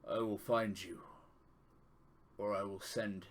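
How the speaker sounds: grave, measured divine speaker; solemn deity voice. Acted. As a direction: speak as a solemn god to his champion. GM voicing a deity's message.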